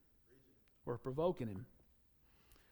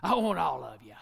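loving father; 8 dB louder than usual. Retold or imitated. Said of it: "his daddy opened his arms up at him like he says".

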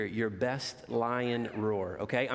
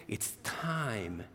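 softly hushed refrain; a hushed secret passed to the children; 2 semitones lower, 5 dB quieter than usual. A narrator's reading. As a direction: let it drop soft and secret. Animals softly sing the refrain.

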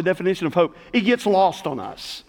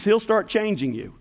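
ordinary delivery

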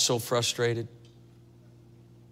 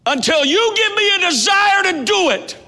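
desperate shout; 10 dB louder than usual. Retold or imitated. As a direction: cry out to God from the floor. Desperate cry to God.

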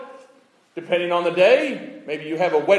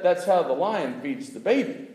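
normal talking voice

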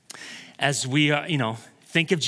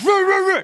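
high loud mock barking at the dog; barking back; 6 dB louder than usual. Pastor barking back at the German Shepherd. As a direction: bark right back at the dog. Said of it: "and I'm like".

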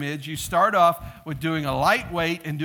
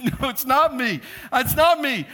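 higher-pitched insistent denial; mock protest; 5 semitones higher, 3 dB louder than usual. Spent lifter answering his spotter, mock protest. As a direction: protest back at the yelling spotter. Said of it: "And I'm like".

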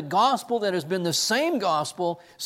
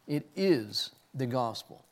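ordinary talk

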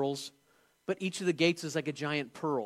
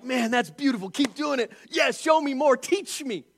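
higher, louder, building to a shout; excited cheering; 6 semitones higher, 6 dB louder than usual. Pretend. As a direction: cheer on a fellow musician with delight. Heavenly musicians cheering each other.

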